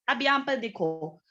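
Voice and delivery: ordinary delivery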